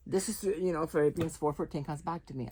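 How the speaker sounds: ordinary delivery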